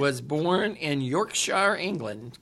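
slow, careful, over-clear delivery; over enunciating. Real, not acted. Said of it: "Why are you talking slow for me?".

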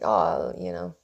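ordinary talk, nothing marked

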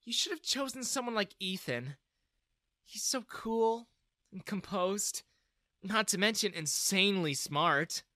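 high voice, lingering on the praise; admiring daughter. A narrator's reading. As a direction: gush lightly about a crush.

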